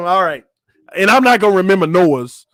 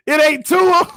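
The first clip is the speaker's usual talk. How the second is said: laughing outcry; 13 semitones higher than usual. Real, not acted. Repeats in disbelief laughing.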